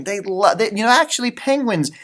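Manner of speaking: plain reading voice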